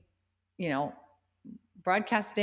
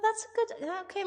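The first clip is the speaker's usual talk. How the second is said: ordinary talk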